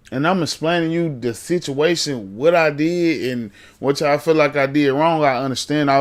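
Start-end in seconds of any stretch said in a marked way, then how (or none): none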